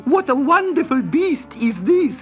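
high, loud squeal of wonder; shrieking Sultana. A narrator's reading. Sultana marvelling at the cat, shrieking. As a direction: squeal in amazement as the princess. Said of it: "shrieked the Sultana".

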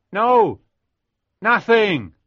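high, loud call up the well; shouted back. A narrator's reading. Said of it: "I shouted back".

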